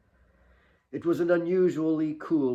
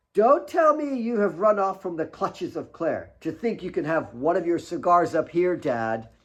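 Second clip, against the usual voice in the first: put-on higher, lightly girlish voice; teasing daughter; 5 semitones higher, 5 dB louder than usual. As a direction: playfully accuse dad. Kate teases her father.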